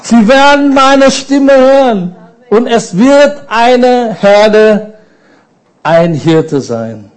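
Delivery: swelling pulpit proclamation; rising preaching fervor. Real, not acted. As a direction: proclaim it to the whole room. Preacher's climax on the promise.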